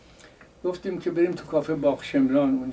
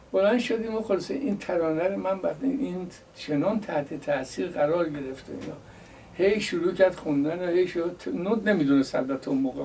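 ordinary delivery

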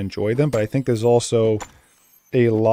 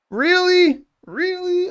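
surprised; 3 dB louder, much slower than usual. Real, not acted. Reacting to a game twist.

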